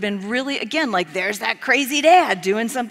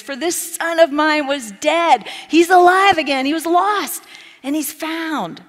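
shouting father; 6 semitones higher, 8 dB louder than usual. Acted. Father proclaiming to everyone that his lost son is home, joyful shouting. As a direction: shout it to the whole village. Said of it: "He might have even been shouting by this point".

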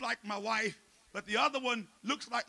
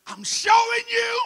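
loud, higher fiery sermon shouting; preaching at full force; 11 semitones higher, 10 dB louder than usual. Acted. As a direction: preach fire into the church.

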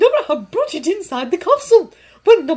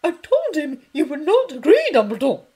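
much higher, much louder outraged woman; angry professor; 11 semitones higher, 12 dB louder than usual. A narrator's reading. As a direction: cut in, furious. McGonagall interrupting angrily.

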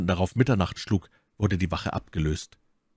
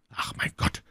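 breathless whispered stammer; Felton, shaken by her words, whispering; 5 dB quieter than usual. A narrator's reading. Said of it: "stammelte Felton".

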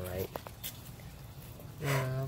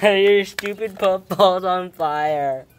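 laughing; 5 semitones higher, 8 dB louder, much slower than usual. Real, not acted. Laughing at friend's puffball.

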